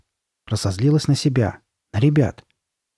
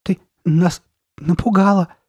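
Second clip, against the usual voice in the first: high, louder, forced protest; uneasy boy; 8 semitones higher, 5 dB louder than usual. A narrator's reading. Steve to the masked girl, uneasy. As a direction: pitch it up, rattled and halting. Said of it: "Стив неестественно засмеялся".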